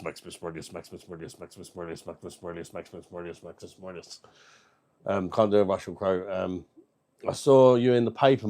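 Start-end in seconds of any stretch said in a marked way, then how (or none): 0.0-4.2 each repeat dropping away, much softer and softer; fading repetition. Acted. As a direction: let the name die away into a murmur. Repeats name while trailing off.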